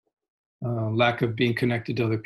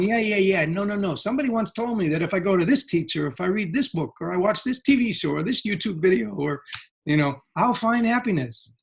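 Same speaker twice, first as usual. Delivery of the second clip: higher-pitched mock excuse-making; busy brush-off; 7 semitones higher than usual. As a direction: act the eager seeker waving off interruptions. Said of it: "And we're going".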